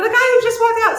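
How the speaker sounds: loud, very high-pitched retold insistence; exasperated cry. Shop owner repeats herself to doubting husband, exasperated cry. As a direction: cry it out in imitated disbelief, voice shooting up.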